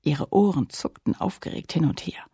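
ordinary delivery, nothing marked

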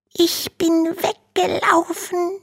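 usual speaking voice

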